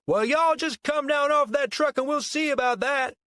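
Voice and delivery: high, loud Southern holler; Southern accent bellow. A narrator's reading. Rival Christ challenging the man on the truck, Southern bellow. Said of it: "bellowed in a southern accent".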